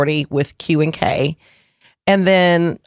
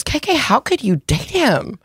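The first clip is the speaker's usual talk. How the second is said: imitating a dead-serious friend, pitched up; higher-pitched, earnest mock appeal; dead-serious pleading; 8 semitones higher than usual. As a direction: plead in disbelief, retelling a friend. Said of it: "so serious, like, had me by my arms".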